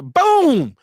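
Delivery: boom. Retold or imitated. Sudden hit in the story.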